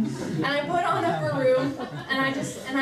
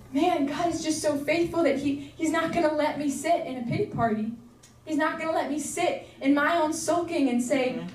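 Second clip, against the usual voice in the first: normal talking voice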